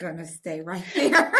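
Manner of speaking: giggling through the words; laughing. Real, not acted. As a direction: laugh it out, embarrassed. Laughing after blushing.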